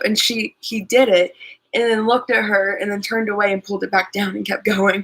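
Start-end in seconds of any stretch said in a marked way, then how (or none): none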